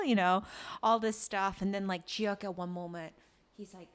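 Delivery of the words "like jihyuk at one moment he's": ordinary delivery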